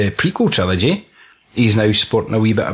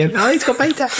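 high mock delight; mock gleeful; 11 semitones higher than usual. Dad imitating himself naming his son, high pitched. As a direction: squeal it like a proud parent. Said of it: "'cause I thought".

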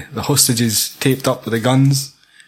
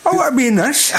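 laughing; 10 semitones higher than usual. Retold or imitated. Mock fan reaction, laughing.

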